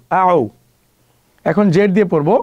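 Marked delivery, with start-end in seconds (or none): none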